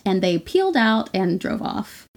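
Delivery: ordinary talk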